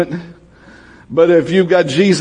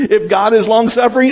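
usual speaking voice

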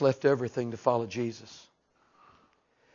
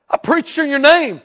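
pleading preacher; 12 semitones higher, 12 dB louder than usual. Acted.

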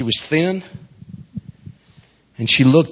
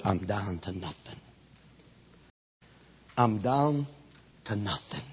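hushed, almost inaudible quote; quiet despair; 6 semitones lower, 14 dB quieter than usual. Retold or imitated. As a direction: sink to a bare murmur, defeated. Retelling woman's despairing words.

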